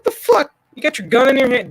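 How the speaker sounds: high, outraged disbelief; shocked high retort at a frozen soldier; incredulous outburst. Retold or imitated. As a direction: pitch shoots up in disbelief. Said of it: "I'm like".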